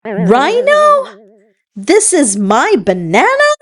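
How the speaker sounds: high, startled question; a monkey shocked to find her banana is a horn. Acted. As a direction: gasp it out in disbelief. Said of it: "the shocked monkey said".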